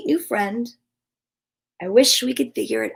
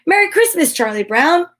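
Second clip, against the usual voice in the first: loud, higher, beaming holiday cheer; cheerful shout; 5 semitones higher, 5 dB louder than usual. Acted. The gang greeting Charlie Brown around the tree, cheerful shout. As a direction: shout it out happily to a friend. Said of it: "smiled and shouted".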